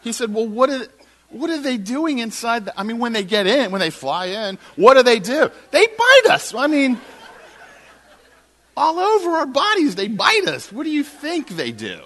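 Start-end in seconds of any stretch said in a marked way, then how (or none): 0.3-5.5 high, loud, stumbling questioning; increasingly frustrated. Retold or imitated. Landlord asking what monkeys do inside, frustrated. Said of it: "he's getting more and more frustrated". 5.7-12.0 very high, loud outburst easing at the end; madder and madder. Retold or imitated. Tenant to landlord about being bitten, furious. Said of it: "So I'm getting madder and madder".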